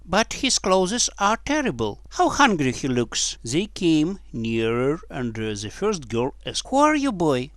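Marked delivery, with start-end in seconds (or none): 0.1-3.4 put-on little girl. A narrator's reading. Second girl answers the first. 6.7-7.5 put-on high girlish questioning; little girl. A narrator's reading. Girl questions the stranger in the barn, high child voice.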